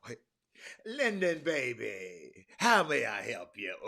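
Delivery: loud, smooth mock-sweet greeting; Powell answering LBJ. Powell answers the phone to LBJ, loud and sweet. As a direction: greet the president like an old friend. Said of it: "That's how he talk".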